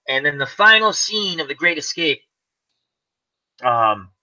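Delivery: usual speaking voice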